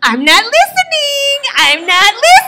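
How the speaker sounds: mock crying child refusing; toddler tantrum. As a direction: cover your ears and shriek.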